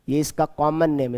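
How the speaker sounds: usual speaking voice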